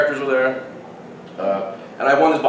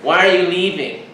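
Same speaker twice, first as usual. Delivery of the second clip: lightly voiced higher, whiny challenge; nagging girl; 5 semitones higher than usual. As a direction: imitate a girl butting in. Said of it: "the one girl comes up to me".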